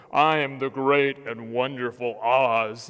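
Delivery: low, loud, booming wizard voice; deep loud proclamation from behind the curtain. Retold or imitated. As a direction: boom it out like the great and terrible wizard. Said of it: "Here they are ... all of a sudden".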